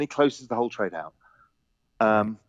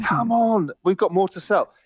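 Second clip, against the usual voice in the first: higher, a bit louder, with a laugh; head of trading pushing for more sales, higher and laughing; pushy trader; 8 semitones higher, 3 dB louder than usual. Retold or imitated. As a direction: play the impatient trader urging more selling.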